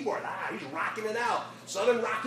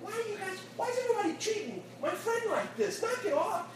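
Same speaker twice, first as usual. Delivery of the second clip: high-pitched, indignant defense of a friend; squeaky outrage, rushing in to defend him; upset protest; 13 semitones higher than usual. Retold or imitated. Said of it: "running over there going".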